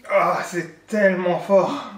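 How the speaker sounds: drawn out, mouth on fire; mouth burning from spicy food. Real, not acted. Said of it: "j'ai la bouche en feu".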